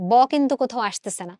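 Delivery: ordinary talk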